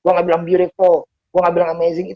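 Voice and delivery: normal talking voice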